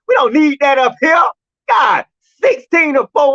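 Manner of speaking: shouting high and loud at the pitcher, fed up; furious rant. Real, not acted. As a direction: blow up at him like a betrayed fan. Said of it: "Red had to come on here and let these emotions out".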